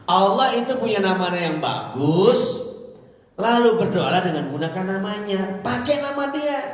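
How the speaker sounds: preacher rousing the congregation; full-force preaching. Acted. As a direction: preach it out to the crowd.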